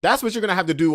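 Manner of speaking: preaching the relationship rule, loud and high; emphatic. Real, not acted. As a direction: hammer the point home, voice up high. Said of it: "this is what I wanna say".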